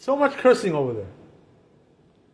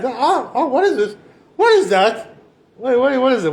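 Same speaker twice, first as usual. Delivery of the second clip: mock surprise, pitched up; acted startled curiosity; 5 semitones higher, 4 dB louder than usual. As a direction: act out stumbling on something shocking. Acts someone seeing a forbidden site.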